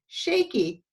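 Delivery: ordinary delivery, nothing marked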